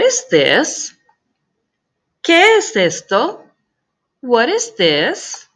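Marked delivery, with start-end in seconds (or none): 2.2-3.4 "¿Qué es esto?" pitched high, rising on the end; rising question. Shown as a demonstration. Teacher repeats "¿Qué es esto?" for the class, high and rising. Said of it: "I would ask the question".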